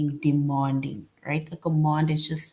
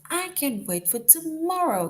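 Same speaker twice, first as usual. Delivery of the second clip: much higher, eager exclamation; excited; 11 semitones higher than usual. Shown as a demonstration. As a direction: sound like the party is tomorrow. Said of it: "which you are excited about".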